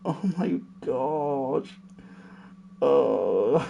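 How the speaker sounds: soft slow tearful laugh; laugh-crying. Real, not acted. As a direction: gasp it out laughing.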